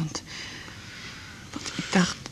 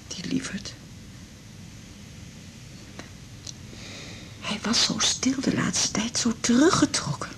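mourning him under her breath; tender grieving whisper; 3 semitones higher, 9 dB quieter than usual. Acted. Widow whispering fondly about her dead husband.

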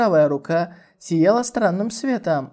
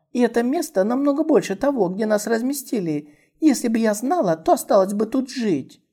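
put-on lively young girl; 6 semitones higher than usual. Girl voiced, envious remark.

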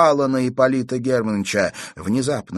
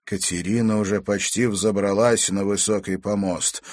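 ordinary delivery

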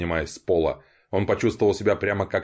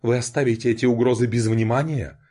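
theatrical; 4 semitones higher than usual. Acted. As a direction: play the wronged victim for the room. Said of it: "театрально воскликнула".

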